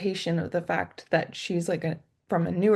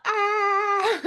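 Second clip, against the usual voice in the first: mock sob; 3 dB louder than usual. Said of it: "I would do the same thing".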